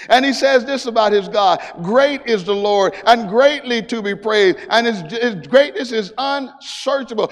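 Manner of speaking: loud, high call and response; full-force preaching. Acted. Preacher calling out to the congregation, loud and high. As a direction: preach it to the rafters.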